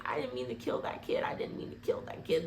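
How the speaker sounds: low murmur sinking into whisper; hushed apology. Acted. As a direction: retell a guilty confession under the breath. Retold guilty apology.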